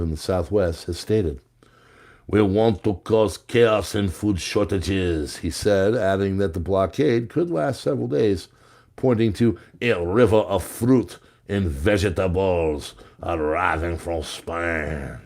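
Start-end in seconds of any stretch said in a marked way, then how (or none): 2.3-5.4 slow put-on French accent. Host reads farmer's threat of chaos, French accent. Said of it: "in my French accent". 9.8-15.2 hammed-up French accent, slowing to the end. Host reads farmer's complaint about imports, French accent. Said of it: "in my French accent".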